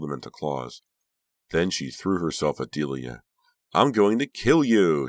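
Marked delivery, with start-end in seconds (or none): 3.7-5.1 slow, high, loud furious scream; screamed threat. A narrator's reading. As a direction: scream it while lunging at a rival. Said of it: "she screamed".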